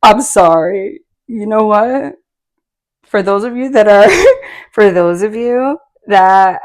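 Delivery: loud, giggly apology; talking through laughter. Real, not acted. Reader apologizes to longtime viewers for cracking up, laughing. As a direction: push the words out loudly between laughs. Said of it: "you know why I'm laughing".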